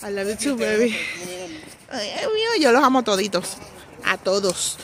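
normal talking voice

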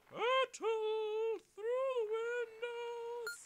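long high wailing cry; frantic cry. Real, not acted. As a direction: wail it slowly, very high. Frantic cry while crashing.

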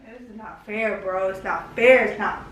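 sulky complaint. Real, not acted. Upset partner sulking after quarrel.

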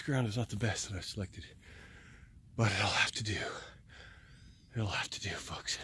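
breathy low whisper; exhausted whisper. Real, not acted. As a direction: barely get it out.

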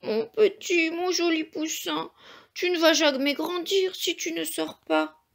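a hint of tears as she begs the egg; plaintive mother hen, gently voiced; mildly sobbing hen. A narrator's reading. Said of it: "Elle sanglotait".

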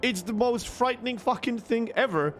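ordinary delivery

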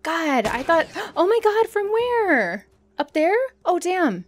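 startled. Real, not acted. Startled by enemy in game.

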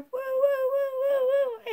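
high, loud whooping; gleeful high hoots; celebratory woo. Real, not acted. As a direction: whoop like a cheering fan. Celebrating making round six.

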